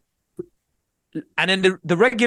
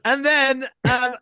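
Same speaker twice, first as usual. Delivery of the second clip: awkward; 11 semitones higher, much slower than usual. Retold or imitated. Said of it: "I'm just there like".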